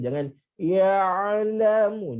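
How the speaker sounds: ordinary talk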